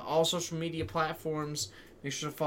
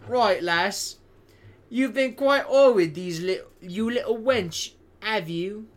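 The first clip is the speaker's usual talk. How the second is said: higher, louder mock cockney rogue; hammed-up British accent; 6 semitones higher, 6 dB louder, slower than usual. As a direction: camp it up like a saucy Victorian villain. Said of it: "in that same voice".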